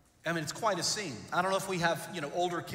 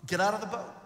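calm invitation; 4 semitones higher, faster than usual. Retold or imitated. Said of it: "and Jesus says".

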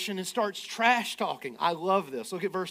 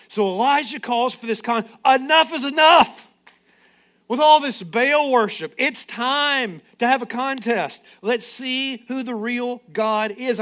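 loud, driving sermon delivery; preaching at full force; 4 semitones higher, 8 dB louder than usual. Acted. Pastor to congregation, preaching loudly. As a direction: preach it hard, build the fire.

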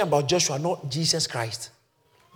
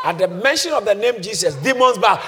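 usual speaking voice

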